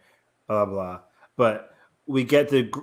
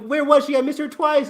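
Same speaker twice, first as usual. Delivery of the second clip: much higher, puzzled question; baffled; 6 dB louder than usual. Retold or imitated. Fan who missed Tiffany Smith twice, baffled and high. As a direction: play it up as bewildered. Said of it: "I'm like".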